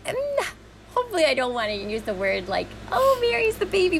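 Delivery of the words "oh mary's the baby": high teasing coo; mock cooing. Guest mimicking how people treat the youngest child, mock cooing. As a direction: pitch it up like fussing over the youngest.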